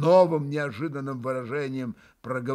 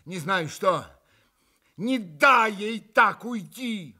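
pressing, slower at the end; begging his brother to stop her leaving; urgent plea; 6 semitones higher, 4 dB louder, slower than usual. A narrator's reading. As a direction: insist urgently. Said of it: "стремительно обратился к брату Митя".

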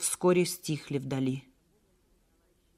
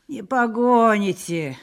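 slow, raised, mocking denial; contemptuous dismissal, pitched up; scornful sneer; 5 semitones higher, 7 dB louder, slower than usual. A narrator's reading. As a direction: scoff loudly, drawing it out. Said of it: "усмехнулся презрительно младший".

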